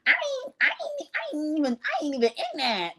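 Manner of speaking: very high, fast, stammering put-on voice; high squeaky mock denial. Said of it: "She's like".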